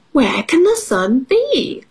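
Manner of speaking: high-pitched puzzled dog character; Dog asking. A narrator's reading. Storyteller as Dog wondering about the sun, high-pitched question. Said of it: "asked Dog".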